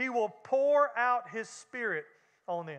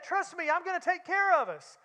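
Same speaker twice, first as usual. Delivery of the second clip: higher, lightly put-on tempter voice; smooth-talking flesh; 6 semitones higher than usual. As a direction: sell them a false promise. Flesh voice promising safety.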